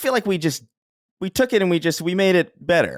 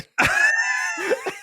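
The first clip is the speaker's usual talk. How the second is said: shrieking laugh. Real, not acted. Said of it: "terrible impression".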